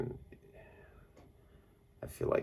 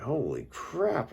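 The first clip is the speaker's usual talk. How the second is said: high, slow amazed exclamation; mock amazement; 12 semitones higher, slower than usual. Recalling sudden new feelings, high amazed exclamation. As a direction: stretch it out in disbelief. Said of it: "And I'm just like".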